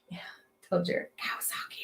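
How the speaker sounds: whispered Kawasaki. Real, not acted. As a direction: breathe the word out. Whispered brand name.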